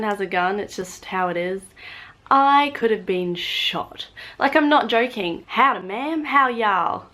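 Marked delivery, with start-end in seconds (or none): 5.5-7.1 higher Southern US greeting; Southern American accent. Retold or imitated. American greeting a lady in a Southern accent. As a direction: put on a folksy Southern drawl accent.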